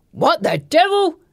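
high, drawn-out startled exclamation; startled sailor crying out; surprised shout. A narrator's reading. Sailor as strangers land in his boat, surprised shouting. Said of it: "a surprised sailor shouts".

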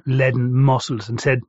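ordinary delivery, nothing marked

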